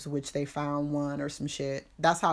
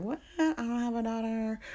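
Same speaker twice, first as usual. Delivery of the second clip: mock bafflement; 6 semitones higher than usual. Mom baffled by repairman's claim.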